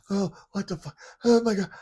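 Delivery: high, loud panicked cry. Retold or imitated. Showing viewers his panic after being shot. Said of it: "I think it was more like that".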